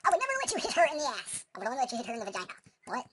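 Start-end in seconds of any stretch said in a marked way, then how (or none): 0.0-1.4 high, loud protest; mock outrage. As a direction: declare it in shrieking indignation.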